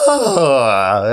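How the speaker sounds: a silly cracked "Oh" sung out on purpose; deliberate voice crack. Shown as a demonstration. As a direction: let the voice break on purpose to look silly. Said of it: "Can you do us a demonstration?".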